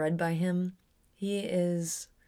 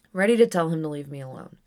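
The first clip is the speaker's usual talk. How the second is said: ordinary delivery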